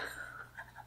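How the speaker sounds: breathy, whispered snicker; sly giggle. Acted. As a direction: giggle under the breath at the prank. Demon revealing her prank.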